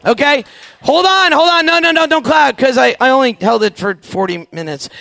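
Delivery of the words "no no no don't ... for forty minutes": ordinary talk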